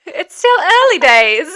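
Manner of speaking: loud, high, drawn-out British accent; British accent attempt. Shown as a demonstration. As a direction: belt out a hammed-up British accent. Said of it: "my weird British accent".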